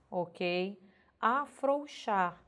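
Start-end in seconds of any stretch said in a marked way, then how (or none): 1.2-2.4 "Afrouxar" devagar, com OU audível; pronúncia correta. Shown as a demonstration. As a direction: marque o ditongo de "Afrouxar". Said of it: "Se você pronunciar corretamente". ("Afrouxar" silabado, a-frou-xar)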